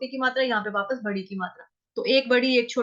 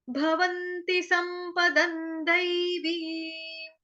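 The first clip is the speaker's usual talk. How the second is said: slow, higher chanted shloka recital; slow sacred chant of the verses; Sanskrit recitation; 5 semitones higher, much slower than usual. Shown as a demonstration. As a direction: intone each verse slowly.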